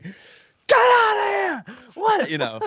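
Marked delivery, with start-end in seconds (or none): 0.0-1.8 slow, high, loud outraged yell; a shout at the child who interrupted the take; retold shout. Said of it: "I was like". 1.9-2.3 incredulous. Retold or imitated. Outraged retold reaction.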